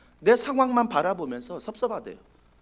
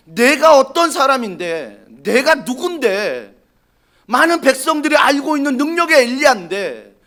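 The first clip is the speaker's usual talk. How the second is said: indignant Elijah; 5 semitones higher, 8 dB louder than usual. Acted. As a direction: insist on who you are. Elijah protesting his importance.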